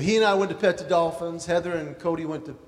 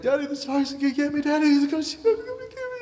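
put-on high, fast, frightened little boy pleading; scared child; 10 semitones higher, 4 dB louder, faster than usual. Father voicing his frightened son at the shark tank. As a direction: whimper like a terrified kid. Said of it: "His head is buried in my leg".

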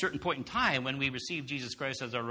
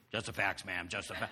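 low, flat deadpan monotone, softer; Joe Friday monotone; 8 semitones lower, 6 dB quieter than usual. Retold or imitated. Husband quoting Dragnet cop in low monotone. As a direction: drone it without any melody like a TV cop. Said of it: "no intonation at all".

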